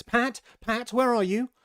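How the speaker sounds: high, loud call for a servant; impatient high-pitched holler. A narrator's reading. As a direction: summon Pat loudly from afar. Rabbit calls out for Pat.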